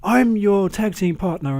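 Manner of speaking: high, loud whiny mock shout; high mock protest, slowed at the end; imitating Daniel Bryan. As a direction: screech it like an upset partner. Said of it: "You can hear him in the background".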